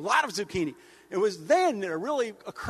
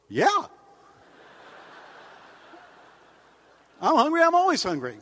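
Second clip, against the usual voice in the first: high, loud eager dog voice; real dog talking; 8 semitones higher, 5 dB louder than usual. Retold or imitated. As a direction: blurt it out like a starving dog. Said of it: "a real dog would go".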